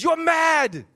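loud high hammed-up British "You're mad.". Shown as a demonstration. Preacher relishing the British translation, loud mock British. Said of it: "I love how that sounds".

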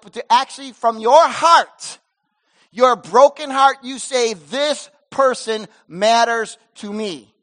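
loud, slow, impassioned preaching; preaching at full force. Acted. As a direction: pastor pleading with the church to go after wanderers, preaching hard. Preaching God's heart to congregation.

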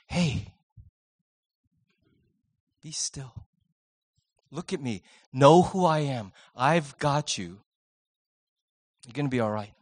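calm a scared listener gently; tender invitation. Pretend. God inviting a frightened person to be still, soft and tender.

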